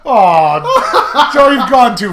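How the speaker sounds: slow and loud through laughter; laughing scold. Real, not acted. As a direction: scold him while cracking up.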